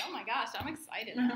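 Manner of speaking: mock excited. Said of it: "so I'm like".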